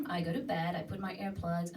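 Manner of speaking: ordinary delivery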